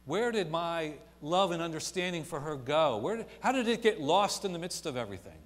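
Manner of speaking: anguished preacher's question; impassioned preaching. Acted. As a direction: cry it out from the pulpit. Preaching at full force.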